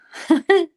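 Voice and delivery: loud high laugh; bright loud giggle; burst of laughter. Real, not acted. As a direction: laugh out at her own joke. Laughs at bleeding joke.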